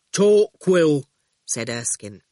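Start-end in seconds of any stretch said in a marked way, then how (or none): 0.1-1.1 louder one-word answer; terse, heavy reply; blunt digger driver. A narrator's reading. Erskine's character voice.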